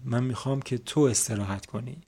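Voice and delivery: ordinary talk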